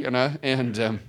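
normal talking voice

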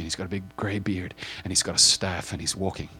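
normal talking voice

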